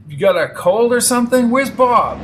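loud, high, impatient questions; urgent questioning. Acted. Brady pressing Peg about Bob, urgent. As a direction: press her for news.

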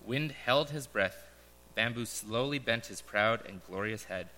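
solemn parable reading with pauses; story narration. Acted. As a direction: read it like a gentle fable to the congregation. Reads a parable aloud.

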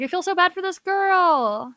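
high, loud mock-sympathetic wail; exaggerated pity. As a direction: gush with hammed-up sympathy. Retelling her naive sympathy while watching.

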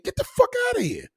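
very high, yelled dismissal; a shrill-free, full-throated yell to get out; mock shout. Dismissing a mandate supporter.

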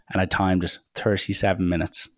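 ordinary talk, nothing marked

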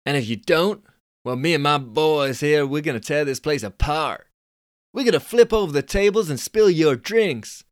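high, loud Californian dude scoff. Acted. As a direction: squeak up the pitch like a cocky Californian. Billy threatening.

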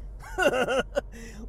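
a bright burst of laughter; goofy laugh. Real, not acted. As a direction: laugh before the reveal. Signature laugh before "listen.

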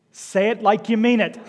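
put-on telling off the child firmly; stern parent. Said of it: "one of the stupidest lines ever".

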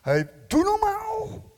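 high, slow, loud mock scolding; mock rebuke. The others in the boat telling Peter to stop, slow mock scolding. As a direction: scold him like an old friend.

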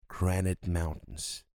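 granite mountains said slowly, T eaten in mountains; swallowed T. Shown as a demonstration. Teacher models the phrase for students. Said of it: "eu como esse T".

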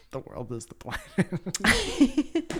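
high, slow words breaking into laughter; laughing. Retold or imitated. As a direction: laugh through the punchline. Retelling his own realisation.

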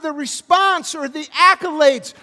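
loud, high preacher's cry, slowing on anyone notices; full-voiced pulpit delivery; fiery preaching. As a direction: preach it out full force to the room. Preaching at full force, unnamed.

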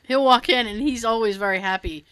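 usual speaking voice

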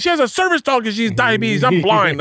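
high-pitched imitation of an exasperated daughter; exasperated correcting. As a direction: set Dad straight in a high, insistent voice. Daughter corrects dad's blind-girl mistake.

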